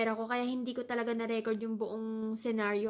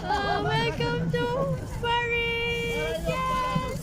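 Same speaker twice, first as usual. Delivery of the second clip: much higher, louder, slow cheer; excited greeting; 12 semitones higher, 7 dB louder, much slower than usual. Real, not acted. Vlogger greeting viewers on arriving in Paris, excited cheer. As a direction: announce it like a delighted host.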